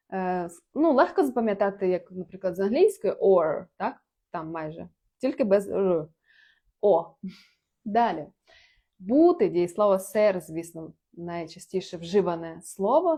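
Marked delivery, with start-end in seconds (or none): none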